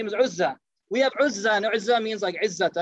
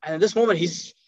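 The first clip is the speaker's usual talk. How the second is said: normal talking voice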